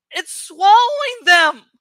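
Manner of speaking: loud, horrified outcry; alarmed cry. A narrator's reading. Tobias sees his friends being swallowed, loud alarmed cry. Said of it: "Tobias cried".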